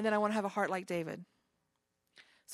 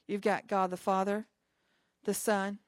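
ordinary talk, nothing marked

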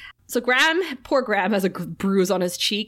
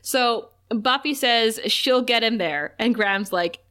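ordinary delivery

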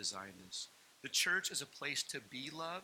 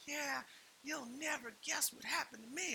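crazy church lady; 12 semitones higher than usual. Retold or imitated. Lady at church answering how she is, mock-gushing. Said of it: "this super crazy lady in our church".

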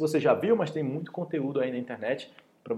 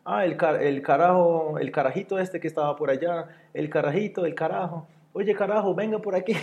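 Venezuelan Spanish imitation; 5 semitones higher than usual. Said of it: "na Venezuela falam".

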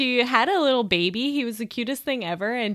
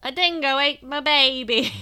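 raised, loud mock-Aussie cry; Australian accent; 5 semitones higher, 6 dB louder than usual. Co-host answers what Australians say, put-on Australian accent. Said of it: "what do they say?".

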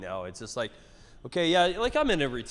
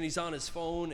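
ordinary delivery

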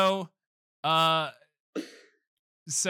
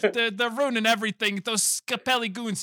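mock alarm; 7 semitones higher than usual. Retelling Luigi's alarm.

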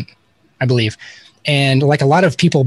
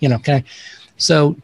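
ordinary talk

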